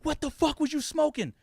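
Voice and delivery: high-pitched imitation of mother demanding; outraged mom. Mom reacting to her son's confession, high indignant imitation. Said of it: "She was like".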